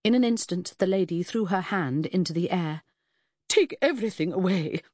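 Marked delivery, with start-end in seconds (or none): 3.5-4.8 grand command. Acted. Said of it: "she commanded the porters grandly".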